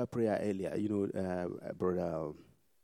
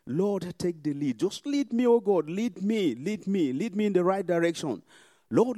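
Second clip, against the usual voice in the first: higher pleading prayer, building up; fervent prayer; 7 semitones higher, 5 dB louder than usual. Real, not acted. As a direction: cry out to God for guidance. Prayer rising in fervour.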